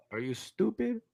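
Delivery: high, put-on voice of an exasperated father; incredulous dad. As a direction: ask it in disbelief. Dad reacting to excuse.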